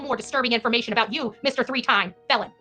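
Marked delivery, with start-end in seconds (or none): none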